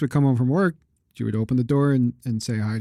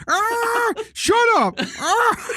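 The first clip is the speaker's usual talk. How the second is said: parrot screeching; much slower than usual. Retold or imitated. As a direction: squawk it like the parrot. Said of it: "the African gray goes".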